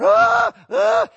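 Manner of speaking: loud, high wordless yell; two short bursts of mock screaming; imitated cry. Preacher showing blood was not just yelling, imitated cry. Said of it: "was not just going".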